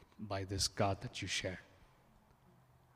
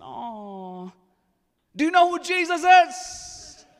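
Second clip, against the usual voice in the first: high, loud, slow pleading question; acted pastor question; 15 dB louder, slower than usual. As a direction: call out to him from high up, drawn out. Preacher voicing himself to Ed.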